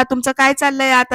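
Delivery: ordinary delivery, nothing marked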